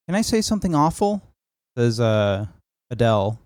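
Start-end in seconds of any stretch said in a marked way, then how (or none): 0.1-1.2 high, quick voice for the wife; high-pitched question. Retold or imitated. Wife asking the marriage counselor, high imitated voice.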